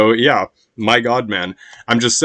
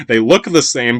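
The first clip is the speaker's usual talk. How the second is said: ordinary talk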